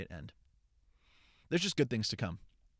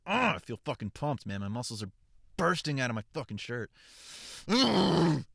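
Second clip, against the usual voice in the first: hammed-up gym-bro brag, louder, grunting; mock macho boast; 4 semitones higher, 7 dB louder than usual. Bragging to viewers about workout, mock macho grunt. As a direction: puff up like a swaggering lifter.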